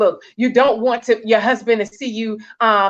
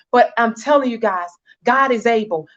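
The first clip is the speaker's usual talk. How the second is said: ordinary delivery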